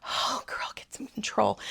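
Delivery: soft whisper to herself; whispering to herself to stop crying; whispered self-scolding. Real, not acted. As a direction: hush yourself, pull it together. Scolding herself after tears.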